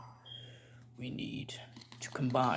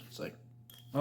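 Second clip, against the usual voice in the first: usual speaking voice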